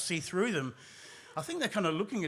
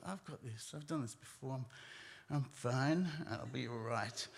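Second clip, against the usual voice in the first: soft, low inner voice; quiet self-reassurance; 4 semitones lower, 9 dB quieter than usual. Retold or imitated. Storyteller trying to hold it together, quietly self-reassuring. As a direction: talk yourself down under your breath. Telling himself he is fine.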